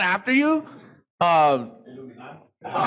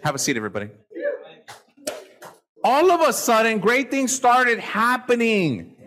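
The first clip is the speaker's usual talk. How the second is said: usual speaking voice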